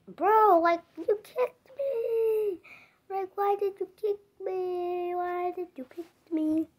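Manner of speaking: high, whiny crying voice. Pretend. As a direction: sob out the complaint. Toy character cries about kick.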